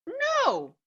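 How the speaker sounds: high, drawn-out no; storybook refusal. Retold or imitated. One book dog turns down another's hat question, high drawn-out refusal.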